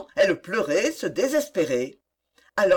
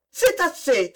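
high-pitched cry, understated in volume; distressed exclamation; 7 semitones higher than usual. A narrator's reading. Young woman stopping the bad news, exclaiming in distress. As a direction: cut in with a raised, distressed pitch. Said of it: "s'écria-t-elle".

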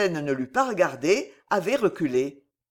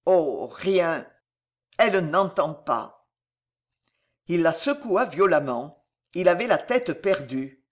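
ordinary delivery, nothing marked

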